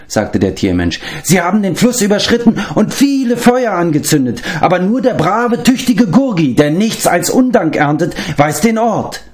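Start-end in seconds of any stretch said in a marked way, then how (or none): 1.2-9.2 a pitiful little creature complaining; whining beast-man. Acted. Gurgi complains he gets no thanks, high whining creature voice.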